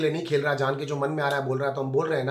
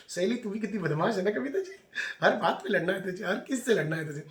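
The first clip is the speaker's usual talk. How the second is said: higher, laughing while teasing; real amused scolding; laughing tease; 4 semitones higher, 4 dB quieter than usual. Real, not acted. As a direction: tease her through a laugh. Laughing jab at Kavita.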